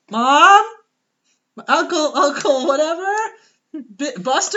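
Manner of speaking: put-on high, loud child's call; kid calling out. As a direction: call for family like a lost little boy.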